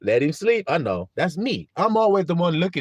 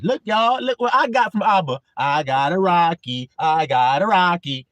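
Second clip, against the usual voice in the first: loud show-off call, then slow sing-song; bragging child; 4 dB louder than usual. Acted. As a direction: show off the prize to everyone. Child showing off a prize.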